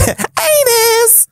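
very high, goofy squeal; absurdly high silly voice; odd silly voice. Pretend. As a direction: squeak it out in a daft falsetto. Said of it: "I don't know where that was".

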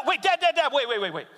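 high, frantic calls to wait; panicked child. Retold or imitated. Scared daughter on the slide begging dad to wait. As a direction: beg dad to stop the count.

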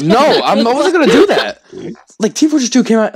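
loud, high, laughing denial; excited protest. Real, not acted. Protesting loudly, laughing friend.

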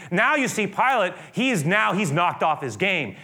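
ordinary delivery

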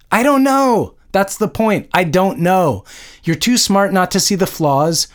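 high-pitched exasperated confrontation; anguished outburst at a parent. A narrator's reading. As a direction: demand answers in hurt frustration. Daughter confronts mother in rain.

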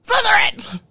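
high, loud shout of Slytherin; booming hat proclamation; Sorting Hat shout. Retold or imitated. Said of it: "it was like".

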